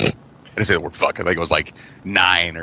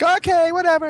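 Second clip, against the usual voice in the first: put-on high, far, bored kid voice. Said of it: "I would just be like".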